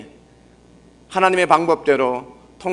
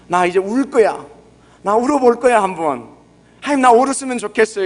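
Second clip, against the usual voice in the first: higher, half-whining pretend plea to cry; a light send-up of someone trying to make themselves cry; mock forced crying plea; 6 semitones higher than usual. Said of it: "이렇게 하지 않아도". (억지로 울려는 사람 흉내, 높고 가볍게 징징대며)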